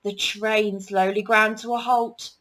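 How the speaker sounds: usual speaking voice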